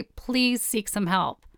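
ordinary talk

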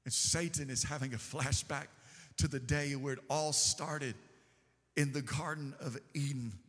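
hushed preaching. Acted. Preacher to congregation, mourning Jesus' death, low hushed voice. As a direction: drop to a near hush as if at a graveside. Hushed retelling of hell's reaction.

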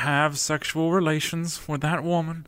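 high put-on Clinton denial; Clinton impression. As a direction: do Bill Clinton's famous denial. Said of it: "Except in an Indian accent".

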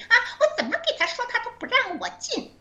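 mock her piercing complaint way up high; high-octave voice. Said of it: "那个高八度的声音".

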